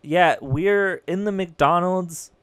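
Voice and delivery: loud, slow shouting into the elevator speaker; shouting up to a faint elevator intercom, slow and loud; yelling into faint speaker. Retold or imitated. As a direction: call out loudly so the faint intercom hears you. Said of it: "I'm like".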